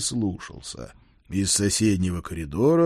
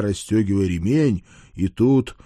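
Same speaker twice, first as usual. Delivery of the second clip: plain reading voice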